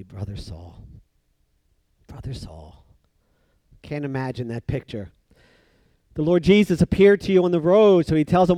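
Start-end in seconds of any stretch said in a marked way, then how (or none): 0.0-2.9 very soft, low and caring; tender greeting. Acted. To the one who is hurting, very soft greeting. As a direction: murmur it gently, then let it rest and repeat. Models laying hands on the hurting.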